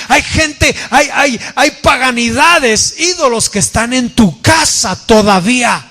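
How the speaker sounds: high, insistent preacher's delivery; full-force preaching. Real, not acted. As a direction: preach it urgently, pitched well above talk. Preaches God's accusation at full force. (predicación enérgica, con voz alta y aguda)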